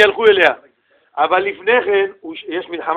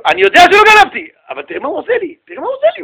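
high pleading "look what he's doing to me"; rising higher and higher in outrage; a hammed-up wronged man crying foul; indignant protest; 10 semitones higher, 6 dB louder, faster than usual. Indignant voiced accused man.

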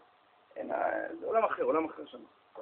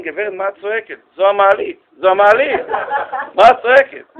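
louder, a bit higher; exasperated manager; 5 semitones higher, 12 dB louder than usual. Retold or imitated. Hotel manager answers the shouting guest, exasperated. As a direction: scold the guest for yelling.

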